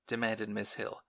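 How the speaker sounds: usual speaking voice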